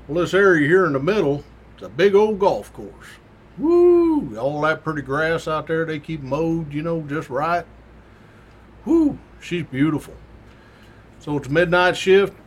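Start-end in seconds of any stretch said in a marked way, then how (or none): 3.6-4.4 admiring woo. Retold or imitated. Admiring the golf course. 8.8-9.2 admiring woo. Retold or imitated. Admiring the golf course.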